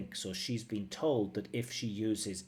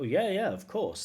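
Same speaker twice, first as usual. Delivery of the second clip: pitched up, lively yeah of course; echoing her eager yes; 8 semitones higher, 5 dB louder than usual. Retold or imitated. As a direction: replay her natural answer with spark. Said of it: "the beautiful language that I know she has".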